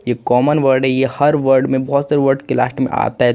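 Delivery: usual speaking voice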